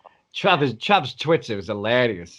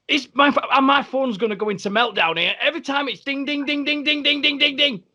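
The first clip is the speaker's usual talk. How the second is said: much higher, flustered mock voice with beeps; imitating panicked Trav. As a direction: play the mate overwhelmed by phone alerts. Trav voiced again, phone dinging.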